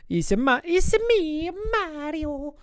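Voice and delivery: high, loud cartoon Italian accent; exaggerated falsetto Italian accent; Mario. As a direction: put on a squeaky-high video game Italian. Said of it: "closest thing I know to an Italian accent".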